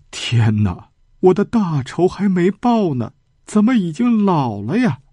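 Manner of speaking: a mild touch of anguished wailing, voice rising high; lightly acted lament, rising up; crying out. A narrator's reading. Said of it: "就大哭起来". (略带哭腔的哀叹)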